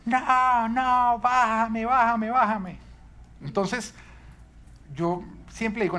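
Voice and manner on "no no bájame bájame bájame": put-on high, loud wail of a kid begging down; loud, high-pitched mock screaming; imitated child's screaming. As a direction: imitate a panicky little boy yelling on a swing. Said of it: "él empezaba a gritar".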